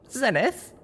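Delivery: hesitant, worried, calling her name; high, tentative call with a rise; worried question. A narrator's reading. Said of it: "I asked as a worry".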